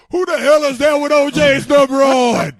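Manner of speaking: loud, slow, high yell across the field; shouted heckle. Retold or imitated. Fan yells at the player wearing OJ's number, loud slow shout. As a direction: bellow it at the rookie in the wrong jersey. Vets yelling from the crowd.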